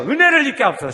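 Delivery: high and loud, 은혜를 hit hard. Shown as a demonstration. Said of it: "다시요". (다시 더 크게 외치기)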